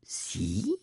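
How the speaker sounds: a dumbfounded query; baffled. Acted. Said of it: "fragte der Sams verblüfft".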